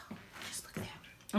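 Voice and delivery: hushed, breathy whisper over the food; awed whisper. Real, not acted. Showing viewers her finished meal, awed whisper. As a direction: whisper like the dish is too good to speak over.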